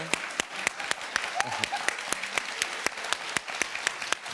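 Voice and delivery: high, loud, breathy burst of laughter. Real, not acted. Host cracking up at the guest's clap, loud laugh.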